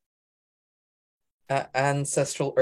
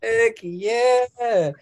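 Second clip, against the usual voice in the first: loud, high, drawn-out cheer; excited cheering; 10 semitones higher, 8 dB louder, much slower than usual. Real, not acted. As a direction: whoop with delight for the poet. Host cheers the poet's reading.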